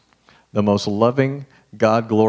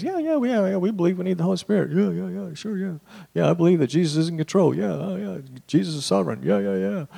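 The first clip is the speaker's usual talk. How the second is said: high, airy, dismissive yeah-yeah voice; mock halfhearted yeahs; 9 semitones higher, faster than usual. Mimicking churchgoers who say yes without meaning it. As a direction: brush it off with empty agreement. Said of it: "we say things".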